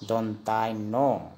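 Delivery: "दोनतानो" slowly, as "dontai-no"; Assamese letter name. Shown as a demonstration. Teacher answering for students, slow "dontai-no" pronunciation. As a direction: stretch "दोनतानो" in parts, ending on o. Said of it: "Asamiya में क्या बोलेंगे".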